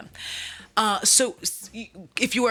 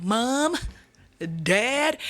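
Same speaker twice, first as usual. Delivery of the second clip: high, questioning call through the house; 3 semitones higher than usual. Acted. He roams his house looking for his parents, calling out high. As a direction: call for missing parents, pitched up.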